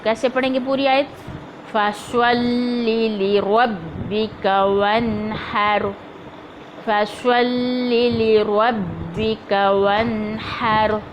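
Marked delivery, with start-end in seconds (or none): 1.7-5.9 each word given its full time, very slow; slow recitation. Shown as a demonstration. Teacher reciting the verse slowly for the class. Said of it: "बहुत तेज़ी में नहीं पढ़ना". 6.9-11.0 each word given its full time, very slow; slow recitation. Shown as a demonstration. Teacher reciting the verse slowly for the class. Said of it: "बहुत तेज़ी में नहीं पढ़ना".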